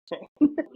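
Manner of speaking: short bright laugh; real laugh. Real, not acted. Telling a listener a funny story, bursts into laughter. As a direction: laugh at your own story.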